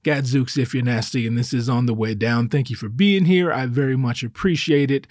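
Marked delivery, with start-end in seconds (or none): none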